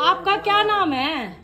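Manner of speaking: high, slow, coaxing question. Real, not acted. Quizzing a small child on names. (बच्चे से प्यार से, ऊँचे सुर में सवाल)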